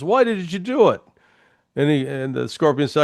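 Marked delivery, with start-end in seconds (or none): 0.0-1.0 a high, changed voice for the sinking frog; lightly voiced dying frog, pitched high; dying frog's plea. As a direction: pitch it up as the drowning frog crying out why. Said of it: "he goes".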